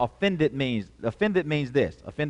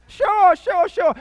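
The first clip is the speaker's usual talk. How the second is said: much higher, louder bright sure sure sure; innocent eager yes; 8 dB louder, slower than usual. Retold or imitated. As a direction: play the naive friend happily agreeing. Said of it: "You know, just innocent".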